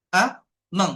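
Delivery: আ, মং as two separate beats; split into syllables. Shown as a demonstration. Teacher asking how many syllables, আ, মং split apart. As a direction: pause clearly between আ and মং. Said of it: "কয়টা আছে".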